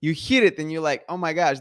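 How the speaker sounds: normal talking voice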